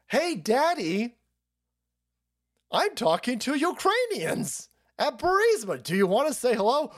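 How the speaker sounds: hammed-up son on phone; childish voice. Acting Hunter and Biden call.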